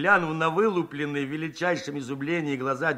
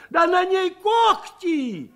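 horrified cry; 12 semitones higher, 3 dB louder, slower than usual. Acted. Woman discovers claws in stew.

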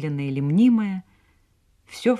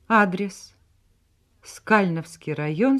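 ordinary delivery, nothing marked

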